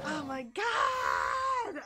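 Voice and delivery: long, drawn-out loud wail; overwhelmed scream. Real, not acted. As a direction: stretch it into a high, wailing cry. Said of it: "I'm screaming, crying, throwing up".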